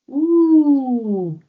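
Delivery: long high falling ooh; loud ooh of dismay. A narrator's reading. Floki climbing out of the swamp, loud high ooh. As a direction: let out a loud drawn-out ooh as you land in the mud.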